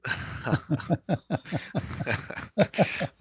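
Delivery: loud breathy laugh. Real, not acted. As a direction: burst out laughing. Caller laughs at the answer.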